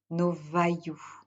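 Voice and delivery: ordinary delivery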